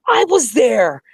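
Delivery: high, loud exclamation; thrilled insistence. Retold or imitated. Fans discovering they shared a concert, thrilled. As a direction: squeal it with amazed joy. Retold thrilled claim of attending.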